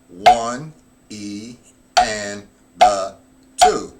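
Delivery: loud, measured beat count; counting aloud. Shown as a demonstration. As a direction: call out the beats for the class. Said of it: "Now we put the fill in".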